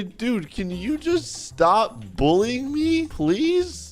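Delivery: high, slow, hammed-up begging; pleading. Victim begging the bully to stop, pleading. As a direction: beg with a drawn-out rising please.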